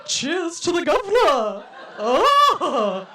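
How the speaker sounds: drawn-out, over-the-top delight at your own workout; mock dirty talk. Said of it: "if I were to dirty talk to myself".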